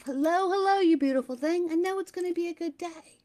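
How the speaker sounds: a beaming hello to your own reflection; cheerful mirror greeting. Pretend. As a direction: greet yourself in the mirror with delight. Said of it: "Imagine looking in the mirror and saying to yourself".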